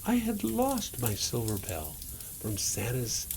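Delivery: plain reading voice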